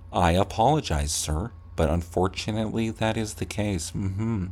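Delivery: a clerk smoothly deflecting with apologies; polite clerk. Pretend. Clerk to an angry customer, soft and overly polite.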